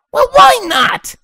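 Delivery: high, loud, slow tomboy bark. Acted. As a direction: bark it back in defiance. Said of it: "Dash barked".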